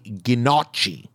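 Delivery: "gnocchi" as ghih-NOTCH-ee in mock Scottish; drawn-out fake Scottish "gnocchi", short O; badly faked Scottish ghih-notch-ee; bad Scottish accent. Shown as a demonstration. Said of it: "with my Scottish accent, I say".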